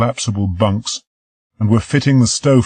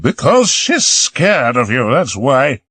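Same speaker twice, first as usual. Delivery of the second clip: angry; 7 semitones higher, 4 dB louder, slower than usual. Acted. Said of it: "she said angrily".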